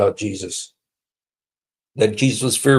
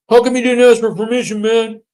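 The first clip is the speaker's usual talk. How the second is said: high, fast mocking squeak of a timid man; mocking a meek Muhammad, high and fast; 12 semitones higher, 5 dB louder than usual. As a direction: mimic a scared little man, pitched way up. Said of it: "He says".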